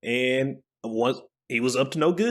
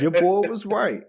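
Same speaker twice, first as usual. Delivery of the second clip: high, loud victory repeat; triumphant boast; 8 semitones higher, 3 dB louder than usual. Real, not acted. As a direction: crow it like a winner.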